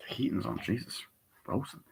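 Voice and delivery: real whisper, muttering about the cold; whispered aside. Real, not acted. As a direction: drop to a whisper, freezing.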